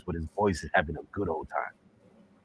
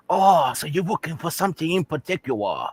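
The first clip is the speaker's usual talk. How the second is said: put-on raised-pitch caricatured Chinese accent; mock Chinese accent; 7 semitones higher than usual. Shopkeeper greets a customer, mock Chinese accent. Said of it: "this Chinese man comes out".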